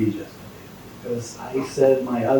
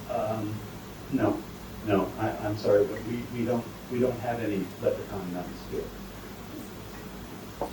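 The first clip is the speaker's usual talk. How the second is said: soft hesitant nun; 4 semitones lower, 10 dB quieter, slower than usual. Acted. Nun answers the leprechauns.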